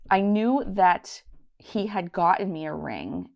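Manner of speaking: usual speaking voice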